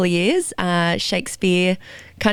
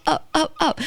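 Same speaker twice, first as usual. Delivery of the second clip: each up climbing higher like an endless question; rising tune demo; 8 semitones higher than usual. Shown as a demonstration. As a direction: sing the ups rising, showing off the Aussie lilt. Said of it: "we go up".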